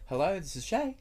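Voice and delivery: high, bright phone greeting; customer service voice. Shown as a demonstration. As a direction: answer the phone sweetly up high. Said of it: "it's up a few octaves".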